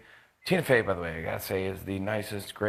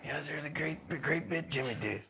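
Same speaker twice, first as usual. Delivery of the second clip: soft, higher, husky-sounding [name]; [name] impression; 5 semitones higher, 8 dB quieter than usual. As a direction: quietly praise the bit like [name] would. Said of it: "He's like".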